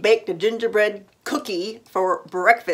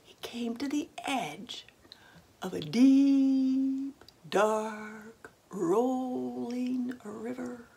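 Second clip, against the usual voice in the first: suspense whisper; 4 semitones higher, 8 dB quieter, much slower than usual. A narrator's reading. Suspense as he reaches river.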